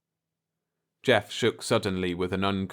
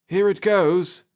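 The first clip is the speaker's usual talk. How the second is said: higher, louder burst of excitement; tinge of excitement; 10 semitones higher, 6 dB louder than usual. A narrator's reading. Pete watching the ship stand upright, excited. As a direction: let the excitement break through. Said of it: "a tinge of excitement in his voice".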